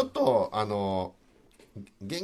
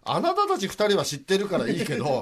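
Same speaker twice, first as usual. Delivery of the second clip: mock-exasperated complaint, pitched up; frustrated listener; 6 semitones higher, 3 dB louder than usual. Listener grumbling at two hosts who already know, mock exasperation. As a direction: play the left-out listener protesting. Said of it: "すごいモヤモヤしながら聞いてましたね".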